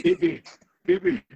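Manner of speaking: put-on high, loud cooing to a baby; Mads Mikkelsen. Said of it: "Mads Mikkelsen talking to a baby".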